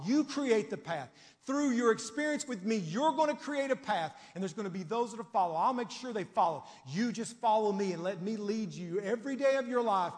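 loud, high, fervent preaching; preaching at full force. Acted. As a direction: lift the whole church with it.

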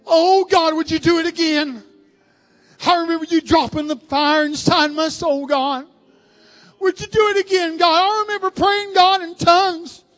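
loud, impassioned crying out to God; full-force revival prayer. Real, not acted. Preacher begging God to do it again, full-force fervour. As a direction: pour out each memory as a loud plea.

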